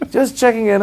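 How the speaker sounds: casual British king. Retold or imitated. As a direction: shrug it off like royalty. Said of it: "he's got a little British accent".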